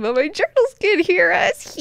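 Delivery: high-pitched silly mock voice; secret gloating. Leto privately thinking about his journals, gloating. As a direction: sneak it out in a squeaky put-on voice. Repeats Leto's secret thought.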